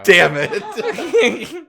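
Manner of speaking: loud, high laughing curse; laughing mock frustration. Real, not acted. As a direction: curse it while cracking up.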